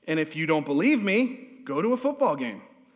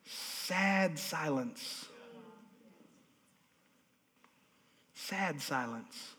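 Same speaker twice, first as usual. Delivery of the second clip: very soft, slow, dropped low; sad silence; 3 semitones lower, 12 dB quieter, much slower than usual. Shown as a demonstration. Preacher showing the church how depression sounds, hushed and slow. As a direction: let it sink into a heavy hush. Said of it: "Depression sounds like".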